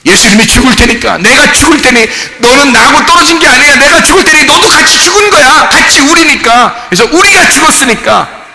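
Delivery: high, loud, impassioned proclamation; sustained fervent shouting at the sermon's climax; full-force preaching. Acted. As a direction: cry it out from the pulpit.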